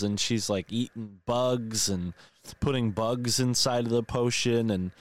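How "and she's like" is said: usual speaking voice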